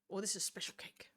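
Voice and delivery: soft unvoiced whisper. Real, not acted. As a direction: whisper it with a mouthful of cake. Eating cake on stream.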